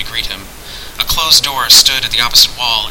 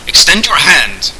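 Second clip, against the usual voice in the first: slow, loud, raised order; commanding sorcerer; 6 semitones higher, 5 dB louder, slower than usual. Acted. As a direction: command it with deliberate weight. Hidden master gives an order.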